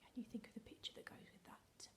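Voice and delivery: hushed, secretive whisper to the class; playful conspiratorial whisper; dramatic whisper. Acted. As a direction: let them in on a secret, whispering. Whispered test continues.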